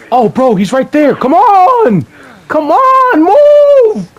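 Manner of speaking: high, loud panicked yelling; panicking gamer. Real, not acted. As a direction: scream at the screen in panic. Enemy right there, panicking.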